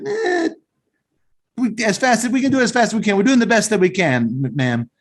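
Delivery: high, fast, flustered counter guy; little Italian guy. Retold or imitated. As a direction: rattle it off high like a hurried pizza man. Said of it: "the little Italian guy's like".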